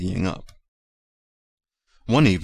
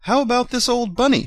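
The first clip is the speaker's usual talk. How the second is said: put-on high, louder old nurse voice; Nana asking; 5 dB louder than usual. A narrator's reading. Nana asking the doctor about the bunny, high voice. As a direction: pitch up for Nana. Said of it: "she asked".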